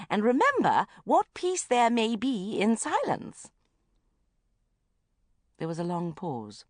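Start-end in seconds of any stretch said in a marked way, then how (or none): 0.1-3.5 slow, solemn recital of a calm creed; serene recitation. Acted. Mother intoning calm advice to her daughter, solemn and slow.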